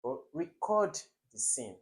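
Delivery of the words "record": "record" stressed on the second syllable, re-CORD. Shown as a demonstration. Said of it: "I have verb here".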